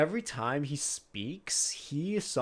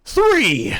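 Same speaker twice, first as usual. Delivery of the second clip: loud shout; 13 dB louder than usual. Acted. GM shouts a count.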